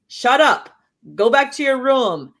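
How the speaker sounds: put-on a parent brushing off a scared kid; mock harsh parent. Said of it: "You don't go".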